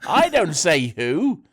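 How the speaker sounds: high, loud, drawn-out protest; exasperated comic rant. Real, not acted. Comic quarrel over botched joke.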